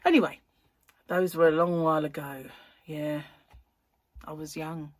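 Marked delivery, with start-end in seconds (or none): none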